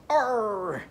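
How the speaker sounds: high, falling pirate "arr"; pirate growl. Acted. Teacher imitates a pirate for children, pirate arr. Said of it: "a picture of a pirate going".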